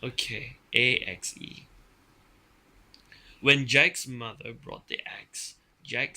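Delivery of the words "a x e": plain reading voice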